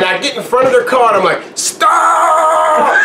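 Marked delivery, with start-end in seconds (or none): 1.6-2.9 long, high drawn-out yell; retold desperate shout. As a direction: flag down a car with a stretched cry. Flagging down a car, retold.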